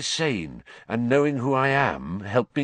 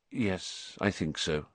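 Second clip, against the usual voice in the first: grimly; 3 semitones lower, 9 dB quieter than usual. Acted. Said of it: "said Lupin grimly".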